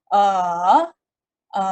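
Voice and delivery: a dipping low then rising; third tone demo. Shown as a demonstration. Teacher demonstrating dipping tone three. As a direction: scoop a down and back up. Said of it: "macam cedok kan".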